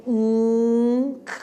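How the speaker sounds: nk said slowly and held, nasal n into k; slow drawn-out nk: n flowing straight into k; demonstrating the nk sound. Shown as a demonstration. Teacher to Spanish speakers: demo of the drawn-out nk. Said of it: "What are you saying?".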